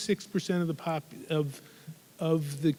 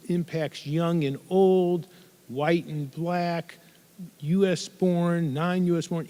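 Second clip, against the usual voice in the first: slow, weighted, each pair of words punched; emphatic list; 3 dB louder, slower than usual. Real, not acted. Speaker telling the audience the epidemic spares nobody, slow and emphatic. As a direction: lay down every pair of words slowly and firmly. Listing who the epidemic hits.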